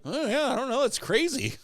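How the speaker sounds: high, laughing, playing dumb; mock innocent. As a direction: act like you know nothing. Feigning innocence to bartender, retold.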